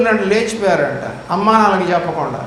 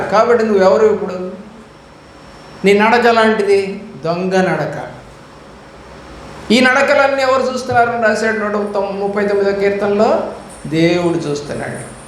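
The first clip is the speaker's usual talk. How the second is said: usual speaking voice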